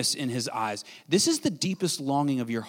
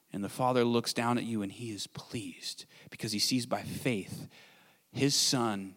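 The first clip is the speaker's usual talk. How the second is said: hushed; 6 semitones lower, 8 dB quieter than usual. Real, not acted. Tender hush in sermon climax.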